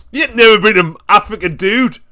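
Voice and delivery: loud, fast, laughing disbelief; excited laughing rant. Real, not acted. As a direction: blurt it out loud, still laughing. Laughing outrage at t-shirt.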